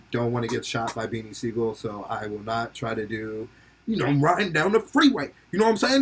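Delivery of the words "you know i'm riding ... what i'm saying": put-on a light Philly street accent, half-tried. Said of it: "a fucking Philly accent".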